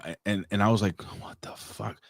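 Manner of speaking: ordinary talk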